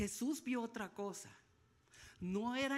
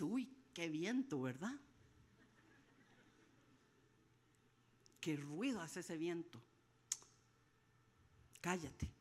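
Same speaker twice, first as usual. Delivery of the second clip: calm hush; 9 semitones lower, 6 dB quieter than usual. Retold or imitated. Jesus calmly hushing the storm.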